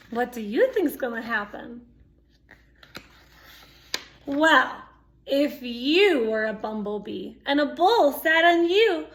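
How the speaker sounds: usual speaking voice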